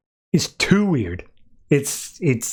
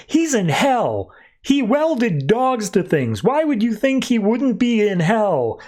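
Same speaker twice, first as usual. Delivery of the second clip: slow, higher-pitched mock exasperation; exasperated retort; 7 semitones higher, slower than usual. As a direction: spell it out as if it's obvious, higher and drawn out. Angry character's retort, acted out.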